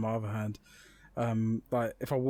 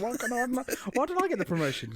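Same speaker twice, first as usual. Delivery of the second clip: high-pitched, envious complaint; comic whine of injustice; mock indignation; 12 semitones higher than usual. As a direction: protest like a passed-over coworker. Envious coworker's complaint acted.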